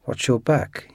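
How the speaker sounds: ordinary delivery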